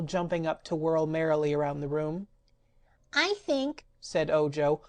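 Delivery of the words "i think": near tears. A narrator's reading. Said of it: "almost ready to cry".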